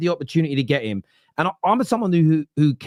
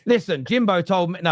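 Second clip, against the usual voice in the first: ordinary talk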